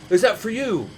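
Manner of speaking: high, loud shouted question; bartender calling out. Retold or imitated. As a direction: holler it like a busy bartender. Acts out bartender across loud bar.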